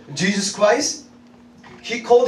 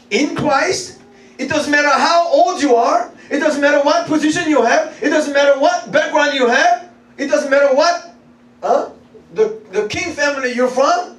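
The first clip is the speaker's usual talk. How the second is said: high, rhythmic, emphatic proclamation; forceful preaching; 7 semitones higher, 3 dB louder than usual. Real, not acted. Preacher telling congregation all are equal in Christ, fervent. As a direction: drive each repeated phrase home, pitched high.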